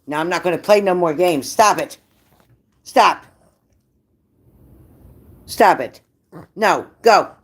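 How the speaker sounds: telling off the dog on a live reading, a firm scold. Real, not acted. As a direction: tell the dog off sharply.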